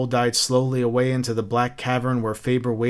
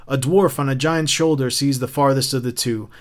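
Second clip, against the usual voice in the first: ordinary delivery, nothing marked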